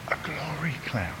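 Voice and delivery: whispered, with reverence; awed whisper. Real, not acted. The speaker whispers in awe about the glory cloud to the church. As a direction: breathe it out in awe.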